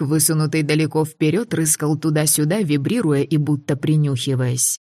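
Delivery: usual speaking voice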